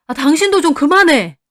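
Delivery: loud, high outburst at a spouse; fed-up, forceful reprimand; exasperated shout. A narrator's reading. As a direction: yell to cut the argument off. Husband breaks up the quarrel.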